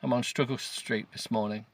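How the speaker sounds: ordinary delivery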